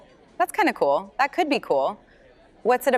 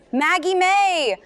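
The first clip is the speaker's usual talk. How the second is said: stretched out, high and delighted; teasing exclamation; 12 semitones higher, slower than usual. Acted. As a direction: draw out the name in gleeful teasing. Aunt teasingly calls niece's name.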